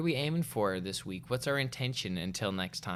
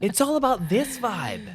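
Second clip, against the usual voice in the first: higher and louder, hyped; 11 semitones higher, 7 dB louder than usual. Pretend. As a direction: sell the vibe with a grin. Playful hyped declaration.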